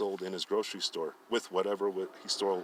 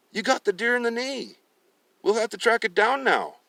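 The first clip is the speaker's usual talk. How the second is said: much higher, louder fatherly voice; old father's voice; 13 semitones higher, 8 dB louder than usual. Acted. Father telling his son to track the deer, high and loud. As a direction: speak as the father, urging.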